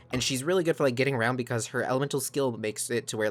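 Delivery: ordinary talk, nothing marked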